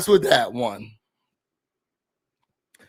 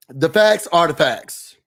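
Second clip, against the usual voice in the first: slow stress on facts; firm verdict again; emphatic; slower than usual. Real, not acted. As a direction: spell it out slowly. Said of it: "repeats verdict".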